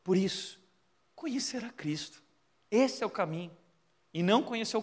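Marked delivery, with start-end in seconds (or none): 1.2-2.2 soft whispered conclusion; whispered emphasis. Real, not acted. Pastor softly presses the point to the congregation, whispering. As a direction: breathe the words out as a secret.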